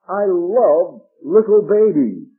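"little" leaned on loud in a slow line; stress on LITTLE. Real, not acted. Preacher joking to congregation about babies, stress on "little". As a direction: punch the word "little", drawing it out. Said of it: "with the emphasis on the word little".

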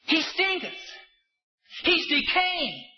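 high, shouted alarm at the tomb; alarmed objection. Retold or imitated. Preacher voicing mourners who shout their horror. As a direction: cry out that the body already stinks.

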